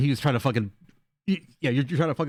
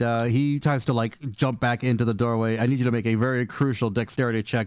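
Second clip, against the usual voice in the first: ordinary delivery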